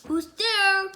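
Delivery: loud, high call; king calling through the door. Acted. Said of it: "called the king".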